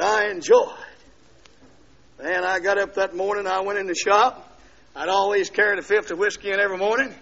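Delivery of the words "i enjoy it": "I enjoy it" whispered, very slow; preacher whispering "I enjoy it" to the crowd. Shown as a demonstration. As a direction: breathe "I enjoy it" out slowly. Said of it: "Some of you endure it".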